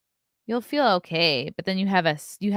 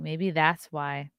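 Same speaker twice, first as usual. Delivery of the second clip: ordinary talk, nothing marked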